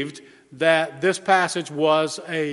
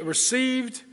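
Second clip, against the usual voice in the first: RECEIVED set apart, high on the stressed end; stressed word; 6 semitones higher, 4 dB quieter than usual. Shown as a demonstration. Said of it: "we stress the words".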